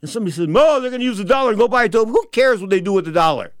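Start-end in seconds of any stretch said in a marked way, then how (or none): 0.5-2.1 high, loud naysayer; mock objection. Passer-by objecting to giving the homeless a dollar, mock indignant. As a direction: mimic a complainer in a raised, louder voice.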